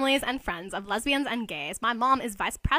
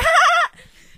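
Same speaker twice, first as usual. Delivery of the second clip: a loud, high-pitched giggle; high shrill giggle; 11 dB louder than usual. Acted. Nelson giggling at his own pun. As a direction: squeal a laugh at your own joke.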